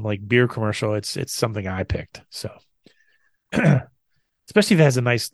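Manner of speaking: usual speaking voice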